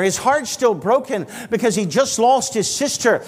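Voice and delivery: usual speaking voice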